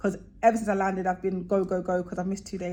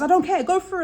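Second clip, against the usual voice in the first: dismissive official; 10 semitones higher, 7 dB louder, faster than usual. Retold or imitated. Dismissive staff member quoted.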